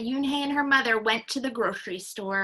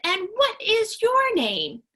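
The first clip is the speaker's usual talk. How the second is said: high, fast friendly shopkeeper; friendly grocer; 10 semitones higher, 7 dB louder than usual. Acted. Grocer greeting the little girl, high and friendly. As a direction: chirp it like a kindly man at the till.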